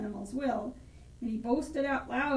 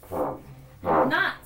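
mild snap, low and louder; a short, forceful retort, lightly played; snappish rabbit; 5 dB louder than usual. A narrator's reading. Rabbit contradicts the bear, mildly snappish. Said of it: "snapped the rabbit".

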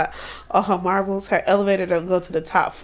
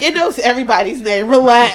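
breezy brush-off getting louder; mock dismissive; 2 semitones higher, 8 dB louder than usual. Friends recapping a show, mocking a brush-off, laughing. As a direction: wave it off with a laugh.

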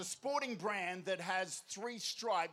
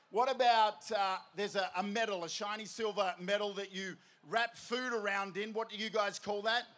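usual speaking voice

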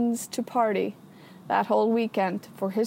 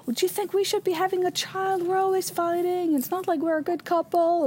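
higher mock voice of an upset partner; angry boyfriend; 7 semitones higher than usual. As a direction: imitate him, accusing and fed up. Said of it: "He was like".

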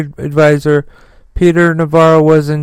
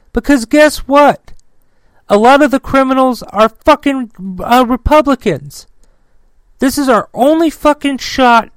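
high-pitched, heated outrage at the Justice Department; furious rant; 3 semitones higher than usual. Real, not acted. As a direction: let the anger boil over, voice pushed up high. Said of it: "I am so fucking livid".

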